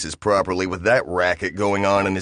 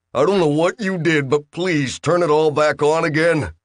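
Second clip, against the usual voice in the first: pleading Bill; 7 semitones higher than usual. Acted.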